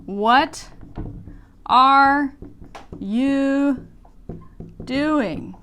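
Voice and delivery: each word separate and slow; What are you doing said word by word; full form. Shown as a demonstration. Teacher spelling out the full question. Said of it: "actually is".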